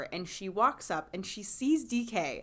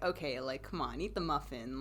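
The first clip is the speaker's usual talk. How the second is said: muttered plea; 8 semitones lower, 8 dB quieter than usual. Retold or imitated. Pleading aside to character.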